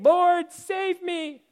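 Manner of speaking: high, restrained cry for help. Acted. Teacher reads sinking Peter's plea, high mild cry. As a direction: call out high without full volume. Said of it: "he cried out".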